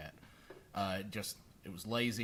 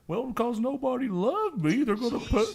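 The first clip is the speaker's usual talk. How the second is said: voicing the cat Brutus; 11 semitones higher, 6 dB louder than usual. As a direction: pretend to be the cat on the phone. Cat voice continues.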